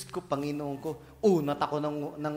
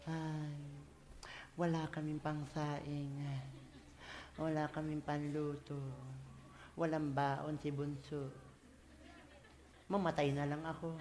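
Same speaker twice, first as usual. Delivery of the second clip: gloomy complaining; 8 semitones lower, 19 dB quieter than usual. Retold or imitated. Pastor mimics a defeated believer, mock gloomy moaning. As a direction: moan like nothing will ever go right. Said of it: "Hindi yung parang nanluluma".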